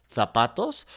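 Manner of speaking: "zapatos" with a TH sound, softly; Spanish from Spain; Castilian accent. Shown as a demonstration. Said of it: "the kind of the T H sound".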